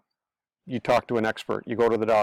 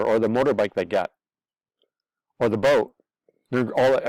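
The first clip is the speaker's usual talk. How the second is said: normal talking voice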